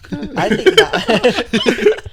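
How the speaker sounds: slow, high, loud theatrical voice; mock grand voice. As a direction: draw it out grandly. Joking drawn-out reply.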